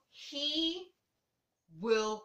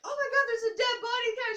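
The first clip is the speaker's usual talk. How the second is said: much higher, louder shocked cry; mock shocked cry; 13 semitones higher, 7 dB louder than usual. Retelling the stage scene to her co-host, mock shocked shout. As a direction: scream it like you found a corpse. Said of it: "They're like".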